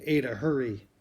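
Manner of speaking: loud, high urgent shout. Acted. Jamie calls Ada to the shelter during the siren, urgent shout. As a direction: yell across the yard to hurry her. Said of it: "Jamie shouted".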